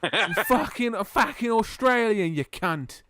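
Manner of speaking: loud, high, hammed-up Aussie accent; mock Australian accent. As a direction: do an exaggerated, over-the-top Australian. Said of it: "hiring me to do an Australian".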